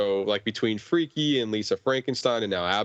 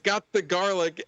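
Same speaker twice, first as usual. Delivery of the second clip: high quoted line; 8 semitones higher than usual. Retold or imitated. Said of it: "she's like".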